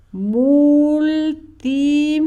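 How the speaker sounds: multi split into two long held syllables; syllable-by-syllable dictation. Shown as a demonstration. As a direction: dictate multi slowly for someone writing.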